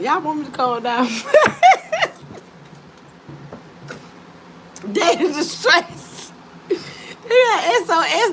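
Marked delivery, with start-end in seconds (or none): 1.1-8.3 laughing. Real, not acted. Laughing fit.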